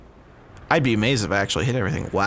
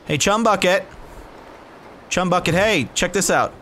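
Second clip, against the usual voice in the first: calling out; 8 semitones higher than usual. Acted. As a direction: yell across at the enemy camp. Taunting enemy base before throwing.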